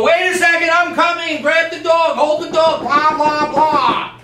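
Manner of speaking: high, frantic calling out; mock a panicked owner answering the door; hammed-up doorbell panic, high-pitched; flustered homeowner. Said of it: "you might go".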